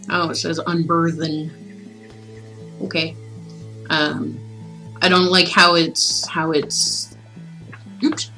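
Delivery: ordinary delivery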